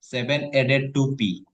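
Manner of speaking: Seven added to P stretched word by word; very slow read. Shown as a demonstration. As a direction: read the phrase out at a crawl. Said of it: "reads slowly for student".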